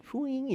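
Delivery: high breathy magic sparkle sound; fairy dust fwing. Shown as a demonstration. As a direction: make a soft high fairy dust swoosh. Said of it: "fairy dust noise".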